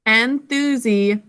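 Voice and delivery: "Enthusiasm" slowly, syllable by syllable; slow choral-practice "Enthusiasm"; sounded out. Shown as a demonstration. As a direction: split "Enthusiasm" into en-thu-si, then asm. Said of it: "Can you say that with me?".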